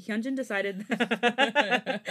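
reading breaks into real laughter; can't get through the line for laughing; laughing while reading. Real, not acted. Reader cracks up mid-sentence, laughing. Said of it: "I'm sorry".

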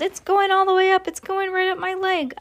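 high-pitched comic panic; panicked yell. A narrator's reading. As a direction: wail it high in alarm. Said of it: "the man yelled".

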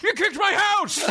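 very high, indignant holler; hammed-up outrage; mock outraged yell. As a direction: imitate an aggrieved owner confronting a culprit. Camper owner confronts the kicker.

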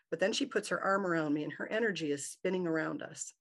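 ordinary delivery

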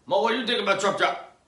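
loud, high, rapid question; calling out. Pretend. Host asking sidekick Mo, loud and fast. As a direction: fire the question across at your sidekick.

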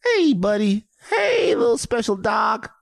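high sing-song doggy talk. Real, not acted. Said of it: "everybody has a dog voice".

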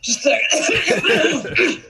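choking on water, coughing; strained, high, slow after choking. Real, not acted. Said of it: "Water went down the wrong pipe".

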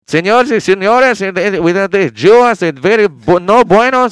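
loud mock Mexican-accented Hitler speech. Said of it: "he's like".

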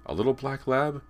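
high, loud follow-up question; demanding. A narrator's reading. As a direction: press for an answer in a boy's high voice. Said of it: "James demanded".

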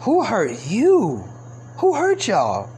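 drawn-out, swooping imitation; mock concerned query. Imitating women's favourite three-word question, mock concern. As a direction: stretch it out as a mocking imitation.

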